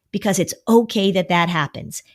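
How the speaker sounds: ordinary talk, nothing marked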